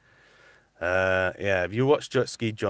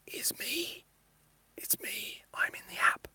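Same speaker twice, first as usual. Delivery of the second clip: whisper; 10 dB quieter, slower than usual. Real, not acted. Confiding Santa secret.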